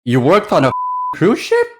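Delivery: shocked question, voice jumping high on ship; can't believe what you just heard; surprised. Real, not acted. Disbelief at cohost's cruise job.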